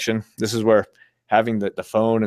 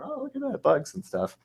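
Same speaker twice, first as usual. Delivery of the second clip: mock wonder; 8 dB quieter than usual. Acts out delight at bugs.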